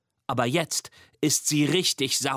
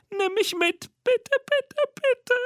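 much higher and louder, a desperate little monster; frantic tiny-monster plea; squeaky begging; 3 dB louder than usual. Acted. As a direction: beg in a rising squeak. Mimi begs to be taken.

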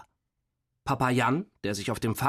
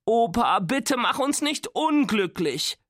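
high, drawn-out plea; imploring whine, slow and high; pleading; 10 semitones higher than usual. A narrator's reading. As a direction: beg grandpa, pitch right up. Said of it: "flehte Nora".

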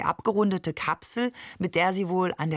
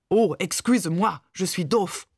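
hammed-up French accent, mock apology. A narrator's reading. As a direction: tease her with a fake-contrite French accent. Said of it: "Mamans französischen Akzent nach". (gespielt reumütig mit französischem Akzent)

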